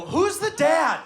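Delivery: high-pitched demanding outburst; comic overreaction. Retold or imitated. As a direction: react with mock alarm, pitched high. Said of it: "so I just react".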